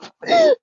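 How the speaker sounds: a squealing burst of laughter; high shriek of laughter. Real, not acted. Laughing fit.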